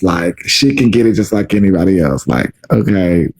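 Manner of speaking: usual speaking voice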